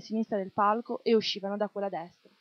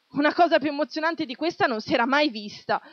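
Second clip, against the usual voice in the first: thrilled shout; 6 semitones higher, 4 dB louder than usual. A narrator's reading. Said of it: "Uno del pubblico gridò".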